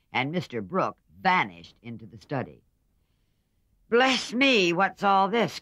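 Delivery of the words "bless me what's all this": much higher, much louder exclamation; a startled old aunt booming out; loud, high old lady voice; old lady crying out. A narrator's reading. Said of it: "cried the old lady".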